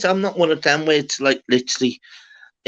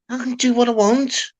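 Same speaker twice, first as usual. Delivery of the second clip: defiant; 10 semitones higher than usual. Real, not acted. Defiant rant about his channel.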